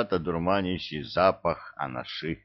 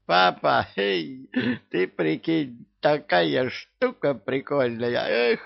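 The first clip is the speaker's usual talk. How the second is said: giddy stoned voice; 7 semitones higher than usual. A narrator's reading.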